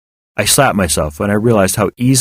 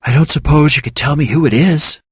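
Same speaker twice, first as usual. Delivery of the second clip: put-on higher, shy sheepish young woman; sheepish receptionist; 7 semitones higher than usual. A narrator's reading. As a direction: ask it shyly, half embarrassed. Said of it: "she asked sheepishly".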